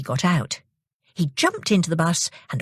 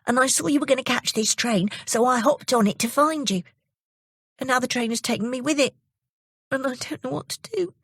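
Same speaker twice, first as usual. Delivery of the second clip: lightly voiced young boy, higher; Jimmy; 7 semitones higher than usual. Jimmy explains to the old lady, lightly voiced boy. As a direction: explain eagerly like a small boy. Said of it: "said Jimmy".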